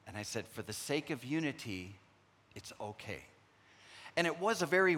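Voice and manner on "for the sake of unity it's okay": soft reassurance. Retold or imitated. Gently calming his crying daughter.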